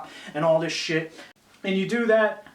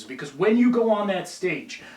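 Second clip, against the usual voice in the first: ordinary talk, nothing marked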